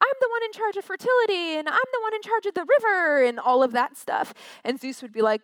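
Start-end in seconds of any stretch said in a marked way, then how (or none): 0.1-3.2 much higher and fast, boasting petty gods; proud little deity voice, pitched way up; squeaky little gods. Retold or imitated. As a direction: play the minor gods bragging in a tiny high voice. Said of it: "all these other little gods would say".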